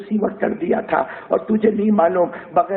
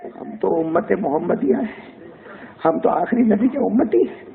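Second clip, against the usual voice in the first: ordinary talk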